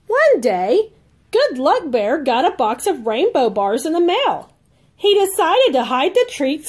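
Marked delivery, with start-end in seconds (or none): none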